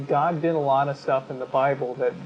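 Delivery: ordinary talk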